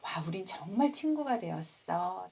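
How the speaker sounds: ordinary talk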